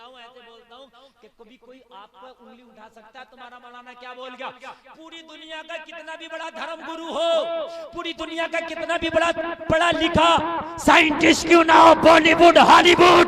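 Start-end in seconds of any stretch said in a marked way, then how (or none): 4.9-13.3 high pitch, swelling into a shout; full-force preaching. Real, not acted. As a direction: build the list to a roar. (ऊँचे सुर में चढ़ती तक़रीर)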